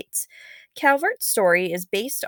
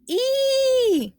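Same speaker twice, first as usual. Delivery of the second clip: a sudden shrill high "Ee!" yelp, much higher; high cry of alarm; 4 dB louder than usual. Acted. Girl fleeing the giant fish cries out, high alarmed yelp. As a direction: squeal out in fright as the earth shakes. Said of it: "Nannetti cried".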